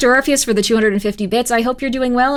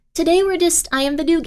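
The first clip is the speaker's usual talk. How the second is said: normal talking voice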